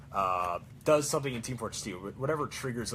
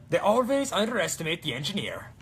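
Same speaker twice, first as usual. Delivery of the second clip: put-on higher, louder Swedish accent; 5 semitones higher, 6 dB louder than usual. Cosplayer quoting Torbjorn's Swedish accent line. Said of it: "That's the Swedish accent".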